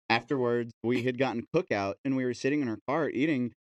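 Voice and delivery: usual speaking voice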